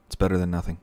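normal talking voice

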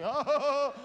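loud high mock gasp of fright; startled yelp. Grown man startled by his own belt, loud mock yelp. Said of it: "sometimes it's like".

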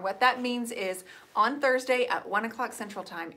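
ordinary talk

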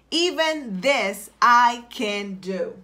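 drawn-out, half-speed declaration; slow, deliberate affirmation. Shown as a demonstration. Coach gives listeners a phrase to type, slow deliberate affirmation. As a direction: stretch out each word with conviction.

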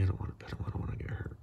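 real soft whisper; hushed aside. Real, not acted. Owner whispers worried aside near the cat.

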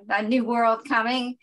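ordinary talk, nothing marked

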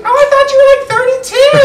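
put-on high, loud surprised girl's voice; high girly voice. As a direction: squeal it like a surprised young woman. Retells girl's reply.

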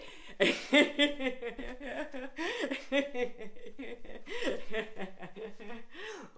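high rolling laughter; staged laughter-yoga laugh; long high laugh. Shown as a demonstration. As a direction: laugh freely as if splashing friends. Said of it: "splash it on each other and laugh".

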